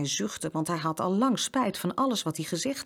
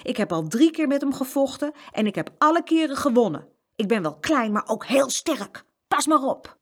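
high, loud boasting; brag, slowing on the strength, then warn; emboldened; 9 semitones higher, 5 dB louder than usual. A narrator's reading. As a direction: bold little-boy bluster. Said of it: "Jeroen steeds dapperder".

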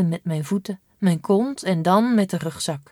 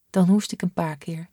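usual speaking voice

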